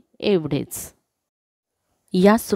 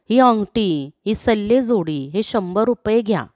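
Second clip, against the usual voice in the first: ordinary delivery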